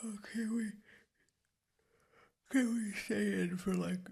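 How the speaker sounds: morning voice. Acted. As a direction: talk like you just woke up in bed. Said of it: "you like my morning voice?".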